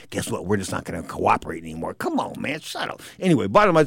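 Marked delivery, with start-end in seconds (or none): 0.1-1.9 much softer mock voice of his failing throat; a tired throat refusing to work; voice talking back. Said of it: "the voice came up to me". 1.9-3.0 hoarse fading. Real, not acted. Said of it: "the voice is telling me to shut up".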